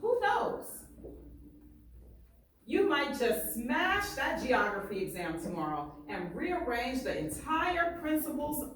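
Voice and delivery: lively read-aloud, painting each picture; story reading. Acted. Teacher reading a barbershop story aloud to students, lively. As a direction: read it to the class with swagger and pride. Reads the book aloud to class.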